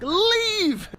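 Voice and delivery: high, sharp retold yell; yelled order. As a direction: snap the single word at him. Yelling at friend on phone.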